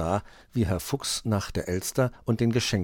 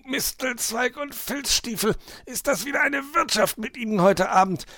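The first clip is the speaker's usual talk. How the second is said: grouse through the effort; grousing, straining fox; 3 dB louder than usual. A narrator's reading. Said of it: "meckerte der Fuchs und keuchte". (meckernder Fuchs, hoch und angestrengt)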